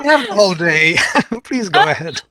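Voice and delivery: real chuckle through the words; amused, laughing as you invite them on; light laughter breaking into speech. Real, not acted. Shared joke about the time.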